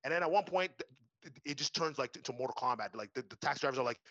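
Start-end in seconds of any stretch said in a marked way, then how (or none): none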